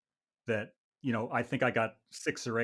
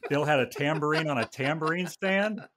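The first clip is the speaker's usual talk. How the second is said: louder, higher, climbing disbelieving question; mock incredulity; 5 semitones higher, 5 dB louder than usual. Musician re-reading his own stupid line, mock incredulous. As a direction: echo it as if it's absurd.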